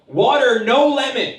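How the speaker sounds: high, loud fussy mom voice; imitating mom. Mom ordering from the waiter, high and fussy. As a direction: order it like a picky mother. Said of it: "she'll go and she'll say".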